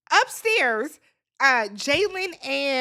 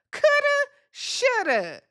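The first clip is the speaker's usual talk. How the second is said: slow, high sing-song "coulda, shoulda"; mocking whine; 10 semitones higher, slower than usual. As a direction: drag it out like a whiny regret. Mocking Huda's regret.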